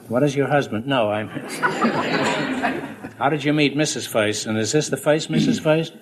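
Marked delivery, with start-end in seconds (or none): 1.3-3.1 airy unvoiced chuckle; quiet breathy laugh. Real, not acted. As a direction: laugh at your own slip. Groucho laughs at own slip.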